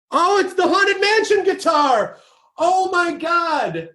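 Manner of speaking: high, thrilled gasping awe; real delight at a dream guitar; excited. Real, not acted. Spotting a treasured guitar on camera.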